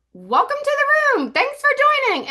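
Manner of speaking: mock welcome. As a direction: chirp a cheery host welcome. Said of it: "I do not say everybody's name".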